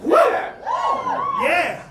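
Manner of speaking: ordinary delivery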